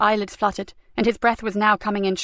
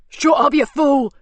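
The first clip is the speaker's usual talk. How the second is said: higher and louder, slow furious scream; 5 semitones higher, 6 dB louder, slower than usual. Acted. Cox screams at his panicking thug, furious. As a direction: slap him down with it. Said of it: "screamed Cox".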